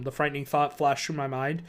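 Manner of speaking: ordinary delivery, nothing marked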